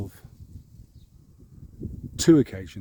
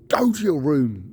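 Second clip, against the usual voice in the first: stern dad; 4 semitones higher, 5 dB louder than usual. Retold or imitated. Dad scolding after the belch.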